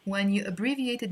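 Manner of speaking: ordinary talk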